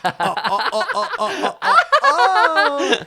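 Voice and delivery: high, laughing oh oh; excited squeal. Real, not acted. Hearing Nikki Glazer is judging, laughing squeals. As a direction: squeal with glee. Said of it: "excited about guest judge".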